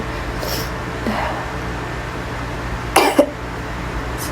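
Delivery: long rasping cough; coughing fit. Real, not acted. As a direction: cough hard, then recover. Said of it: "Sorry about my coughing".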